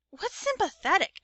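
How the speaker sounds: put-on high little-boy voice; lightly voiced puzzled child; little brother. A narrator's reading. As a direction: ask it like a second grader. Small brother asks a question.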